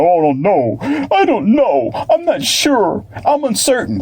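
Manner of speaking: high, hammed-up hesitant voice; mock timid voice. Mocking scared, uncertain people, high and timid. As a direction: parody someone too scared to commit. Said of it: "where people are scared".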